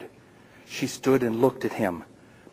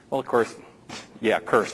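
ordinary delivery

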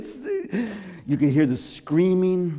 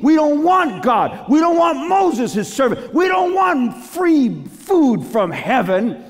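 rebellious shout; 11 semitones higher, 6 dB louder than usual. Retold or imitated. Angry Israelites rejecting God.

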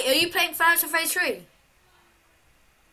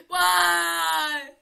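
whining wail. Real, not acted. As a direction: wail it at the game. Wailing at the game.

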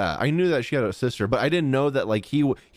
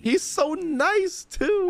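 gushing squeal; slower than usual. Real, not acted. Fan gushing over a game character.

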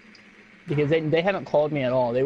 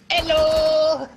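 mock hello; 12 semitones higher, 4 dB louder than usual. Mock greeting to enemy.

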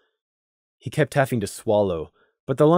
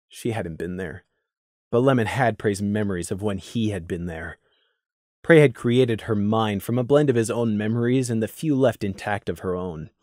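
ordinary delivery

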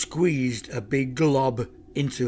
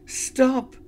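high, loud cry to halt; sudden high-pitched plea; urgent cry; 11 semitones higher, 5 dB louder than usual. A narrator's reading. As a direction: cry out to hold off an attacker. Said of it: "Lucy cried".

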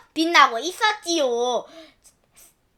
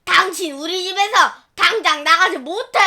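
louder angry yell; wife yelling at the stranger to get out; shrieking at her; 4 semitones higher, 6 dB louder than usual. Acted. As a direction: throw her out of the house. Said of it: "소리를 꽥 질렀어요".

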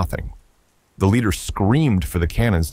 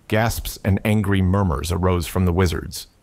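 ordinary delivery, nothing marked

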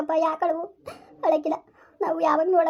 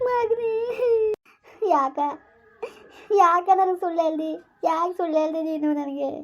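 weeping reproach. Acted. Grieving parent asking why they were lied to, weeping reproach. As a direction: wail the questions through tears, with long breaks. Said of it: "ನೀನು ಅಳ್ಬೇಡ".

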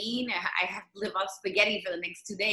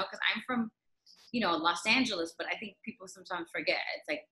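ordinary talk

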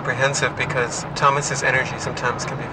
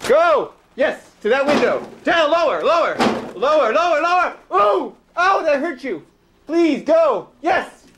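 loud, much higher cries; frantic shouting; 4 dB louder, slower than usual. Real, not acted. Frantically yelling at pigeons to fly out the window.

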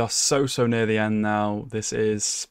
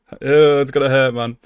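louder, mock-anguished groan; 3 semitones higher, 8 dB louder than usual. Real, not acted. Viewer dreading the finale, louder mock anguish. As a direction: wail about the coming finale. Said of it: "Next week's gonna hurt".

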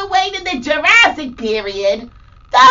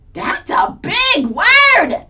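mock amazement; 7 semitones higher, slower than usual. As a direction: act wowed for the kids. Gasp at big word.

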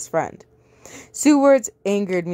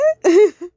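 sudden high burst of laughter; short high giggle; surprised laugh; 12 semitones higher, 8 dB louder than usual. Real, not acted. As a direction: laugh in disbelief. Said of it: "wait, what?".

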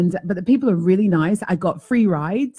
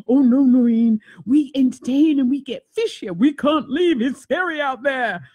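higher playful sea lion reply; pretend sea lion voice; 6 semitones higher than usual. Sea lion refusing the host, higher playful voice. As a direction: answer as a cheeky, well-fed sea lion. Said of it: "I was making up this whole story".

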